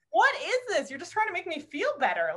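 high-pitched, incredulous questioning; retold bewilderment. Speaker to ex after surprise pampering, in mock bewilderment. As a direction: act out baffled disbelief at a kindness.

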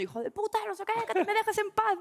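high-pitched yelling of insults; mock yell. To her instructor in class, a high mock yell. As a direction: shriek it like in a fight class. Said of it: "Y estoy gritando".

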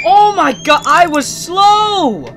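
loud, high cry of alarm; real startled yell at a game scare; startled outburst. Real, not acted. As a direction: yelp it out, dragging out the last word.

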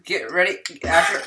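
normal talking voice

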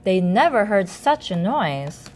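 ordinary delivery

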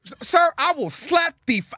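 high-pitched indignant threat; heated outburst. Retold or imitated. Telling off the man at the restaurant, heated. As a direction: act out losing your temper, voice way up.

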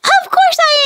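high, fast cartoon puppet chatter; squeaky puppet voice. Fizzy the puppet cheering Phoebe, high cartoon voice. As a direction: chirp it like a tiny cartoon friend.

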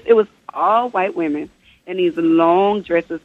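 ordinary talk, nothing marked

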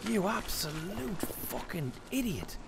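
high, slow stretched-out insult; drawn-out exasperation. Real, not acted. Gamer groaning at his stuck character, high slow despair. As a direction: drag every word out in despair.

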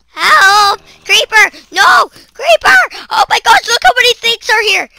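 high loud frantic cries for help; panicked screaming. Real, not acted. Gamer surrounded by creepers, panicked screaming. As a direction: yell in real panic.

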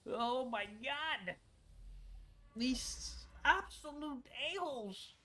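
high, drawn-out wail of outrage; exasperated outcry. Real, not acted. Gamer cursing the game over a key, exasperated outcry. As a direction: stretch it out in exasperation.

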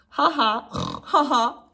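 a playful mock laugh; a light, hammed-up ha ha; grandpa's funny laugh. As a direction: imitate grandpa's silly chuckle. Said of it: "laugh at Grandpa's funny noises".

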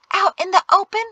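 high, incredulous question; high-pitched indignant disbelief; scandalized. A narrator's reading. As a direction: ask as if horrified. Jatesy scandalized, arguing back.

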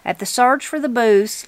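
usual speaking voice

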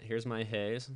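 ordinary talk

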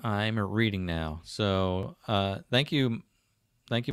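normal talking voice

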